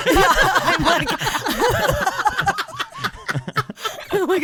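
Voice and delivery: high giggling fit; laughing. Real, not acted. Laughing at the joke.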